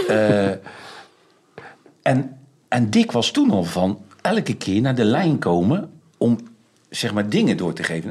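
ordinary talk, nothing marked

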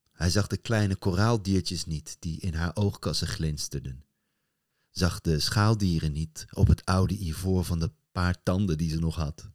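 plain reading voice

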